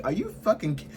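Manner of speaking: are you kidding me, incredulous; outraged disbelief. Real, not acted. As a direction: pitch shoots up in disbelief.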